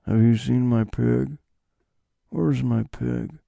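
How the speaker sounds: slow, understated loner impression; Nick Cage. Asking someone else about his pig, understated impression. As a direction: ask it slowly, giving little away. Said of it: "that understated style of delivering his lines".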